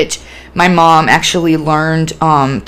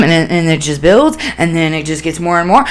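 usual speaking voice

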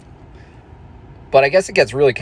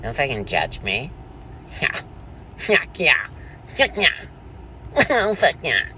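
tag; 4 semitones lower, 5 dB quieter than usual. Acted. Plays a masked Q fan.

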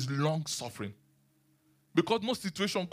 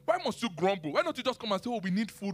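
higher, exasperated rebuke; exasperated God; 8 semitones higher than usual. Retold or imitated. God rebuking the grumbling Israelites, in higher exasperated tones. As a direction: scold them like a let-down parent. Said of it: "God was like".

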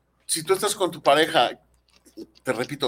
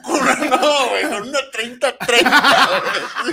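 laughing; 4 semitones higher, 7 dB louder than usual. Real, not acted. Joking while laughing.

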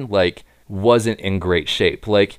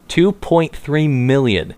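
slow, higher-pitched emphatic figure; slow, deliberate repeat; 5 semitones higher, slower than usual. Real, not acted. Repeating a staggering figure for co-host, slow and deliberate. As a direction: spell out the amount slowly and emphatically.

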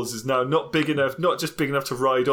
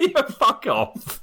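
mock voice; 11 semitones higher, much slower than usual. Mock reply in put-on voice.

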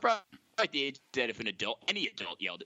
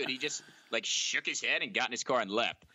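ordinary talk, nothing marked

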